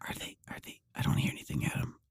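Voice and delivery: whispered, straining to hear. Retold or imitated. Host imitating himself whispering into silence. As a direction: hush it like you hear nothing. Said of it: "I always pause, and I'm like".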